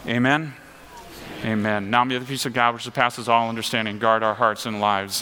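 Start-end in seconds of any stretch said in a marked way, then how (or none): 1.9-5.2 soft, low blessing; benediction. Acted. Pastor blessing the congregation in closing, soft and low. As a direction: bless them gently in closing.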